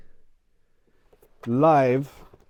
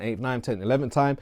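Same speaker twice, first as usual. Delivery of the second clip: ordinary delivery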